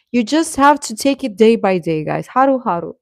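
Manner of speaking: ordinary delivery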